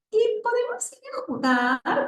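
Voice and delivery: put-on high, slow baby talk; babyish voice. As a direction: talk like a pampered little boy. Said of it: "así hablaba".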